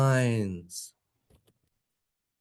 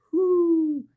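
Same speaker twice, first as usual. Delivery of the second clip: hoo hooted much higher and louder like an owl; 14 semitones higher, 4 dB louder than usual. Pretend. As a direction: hoot it like an owl at night. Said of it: "you're an owl".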